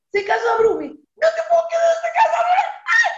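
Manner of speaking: grito de alegría. Retold or imitated. Grita de alegría por la boda.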